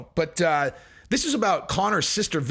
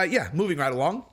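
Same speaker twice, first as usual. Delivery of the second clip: ordinary delivery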